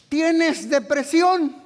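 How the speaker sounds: high, loud, slow question; shouted question. Acted. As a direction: hammer the question at the crowd. Calling the congregation.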